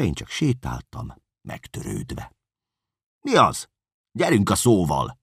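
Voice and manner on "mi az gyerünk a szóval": high, loud urging shout; startling bark. Acted. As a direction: jolt him into speaking. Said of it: "riasztott rám Kerekes úr".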